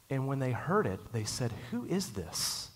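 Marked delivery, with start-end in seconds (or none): 1.7-2.7 slow, higher, hushed "Who is this?"; a hushed, awed crowd asking who he is; amazed question. Retold or imitated. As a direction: breathe it out in wonder. Crowd marvels at Jesus.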